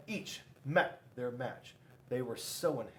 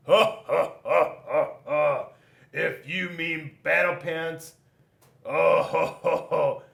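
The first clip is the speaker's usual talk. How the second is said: loud, big mocking laugh; booming laugh; 4 semitones higher, 10 dB louder than usual. Rock laughs loudly at Scissors' taunt, booming laugh. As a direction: laugh it out loud and hearty like a brash warrior. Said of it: "And Rock laughed".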